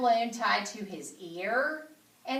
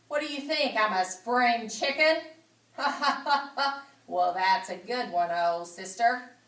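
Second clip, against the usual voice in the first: hearty laugh at his own joke; 3 semitones higher, 5 dB louder than usual. Acted. The pig chuckling at Charlotte over his joke.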